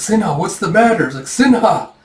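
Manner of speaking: high, urgent call; alarmed calling. Acted. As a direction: call out to someone in distress.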